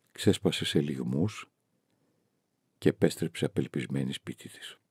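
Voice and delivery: plain reading voice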